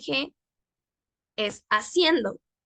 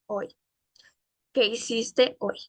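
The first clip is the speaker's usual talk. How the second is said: ordinary delivery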